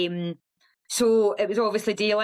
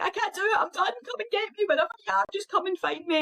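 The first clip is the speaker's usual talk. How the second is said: high, tearful pleading, much higher; retold crying plea; 13 semitones higher, faster than usual. Begging her husband on the phone to fetch her, high tearful plea. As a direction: beg through tears, voice cracking high. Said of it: "I was just screaming at him".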